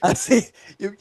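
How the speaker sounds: ordinary talk, nothing marked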